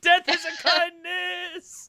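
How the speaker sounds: loud, high, drawn-out cry through laughter; hammed-up shout with a laugh; mock proclamation. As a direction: proclaim it grandly, stretching it out, laughing. Game master mock-proclaims a dark joke.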